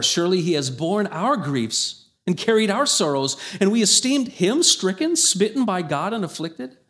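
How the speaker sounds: preacher reading Isaiah aloud to the church, lifted and solemn; scripture reading. Acted. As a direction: proclaim the verses to the congregation.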